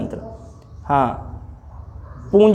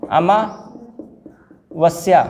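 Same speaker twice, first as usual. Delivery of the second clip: Amavasya stretched out syllable by syllable, a-maa-vas-yaa; slow dictation; 2 semitones lower, much slower than usual. Shown as a demonstration. Teacher reading the word for students to write, very slow. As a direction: sound out Amavasya very slowly. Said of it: "अमावस्या हमने सही लिख दिया".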